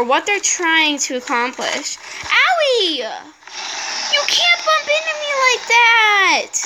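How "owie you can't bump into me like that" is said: 高く引き伸ばした泣き言; ぶつかられて抗議する子どもっぽい声; whiny complaint. Real, not acted. Knocked around by the dragons.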